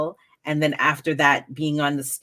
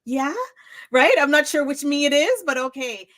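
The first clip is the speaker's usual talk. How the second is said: unsure inner reply; 11 semitones higher, 3 dB louder than usual. Retold or imitated. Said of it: "I'm thinking".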